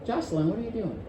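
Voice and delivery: quiet, mild question; gentle hushed inquiry; soft gentle tone. Shown as a demonstration. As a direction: ask it softly, no edge. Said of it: "I used exactly the same words right there".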